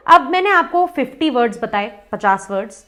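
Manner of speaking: normal talking voice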